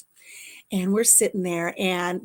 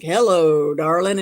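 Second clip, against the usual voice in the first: a hint of a crooner's low greeting, louder; lightly imitating a singer greeting his audience; 4 semitones lower, 7 dB louder than usual. Singer on a bar stool greeting the crowd, lightly crooned. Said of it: "he's like".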